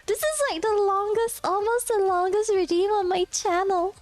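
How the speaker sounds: very high-pitched squeaky character voice; high put-on cartoon voice; Paimon. Shown as a demonstration. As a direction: pitch it way up, like a little mascot. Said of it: "with this voice".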